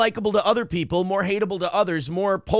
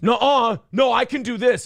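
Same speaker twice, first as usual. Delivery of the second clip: higher and louder, insisting; imitating a stubborn shout; 5 semitones higher, 4 dB louder than usual. Imitating the writer refusing his lawyer's advice, raised voice. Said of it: "guy just goes".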